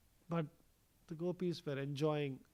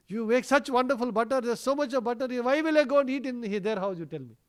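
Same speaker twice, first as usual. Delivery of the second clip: high, louder mock-aggrieved child; innocent protest; 6 semitones higher, 5 dB louder than usual. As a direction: argue your innocence to mother in one rush, easing down at the end. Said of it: "Krishna very innocently, he will rub his eyes, you know, as if he's crying".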